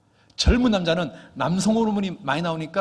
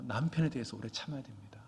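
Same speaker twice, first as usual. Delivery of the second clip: hushed whisper, leaning in; 5 semitones lower, 8 dB quieter than usual. Real, not acted. Preacher whispering advice to the wives in the pews. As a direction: whisper it like a secret.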